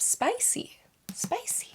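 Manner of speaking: high, breathless whispered spicy; coy high whisper; teasing whisper. Real, not acted. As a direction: tease it like a naughty secret. Teasing about spicy book.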